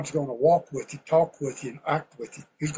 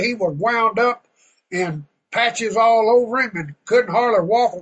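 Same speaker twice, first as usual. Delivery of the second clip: ordinary talk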